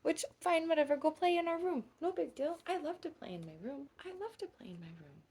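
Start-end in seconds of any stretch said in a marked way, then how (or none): 4.1-5.2 lower, softer and fast, almost to herself; soft quick echo. Acted. Echoing to a little friend in a soft quick murmur. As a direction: tuck the repeat away quietly.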